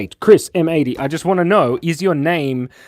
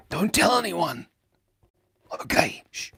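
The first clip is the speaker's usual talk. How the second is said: secretive hush; 5 semitones higher, 5 dB quieter than usual. Pretend. Secret about being Panda Man.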